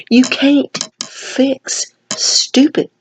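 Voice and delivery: slow, drawn-out Southern saying. Retold or imitated. Vlogger quoting a Southern saying at a stubborn girl, slow Southern drawl. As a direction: drawl it out slowly like an old Southern proverb. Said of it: "as they say in the South".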